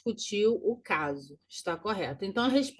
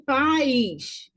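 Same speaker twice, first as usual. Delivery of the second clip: "país" drawn out with both vowels distinct; separated syllables; 3 semitones higher, 4 dB louder than usual. Shown as a demonstration. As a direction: stretch "país" into PA-ÍS. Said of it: "eu separo a sílaba". ("país" alongado, separando as vogais)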